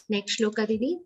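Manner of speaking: ordinary talk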